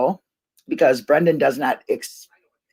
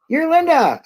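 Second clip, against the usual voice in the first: much higher, delighted recognition; imitating Jinxie; 13 semitones higher than usual. Retelling a fan recognizing her, high and delighted. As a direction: squeal her name like spotting a celebrity. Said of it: "when Jinxie came up to me and goes".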